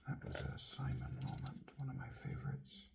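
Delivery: soft unvoiced whisper. Real, not acted. Reminiscing about a favorite Simon moment in a whisper. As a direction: murmur it to yourself. Aside while searching pages.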